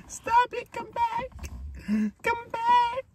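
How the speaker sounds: very high whiny plea; squealed plea. Real, not acted.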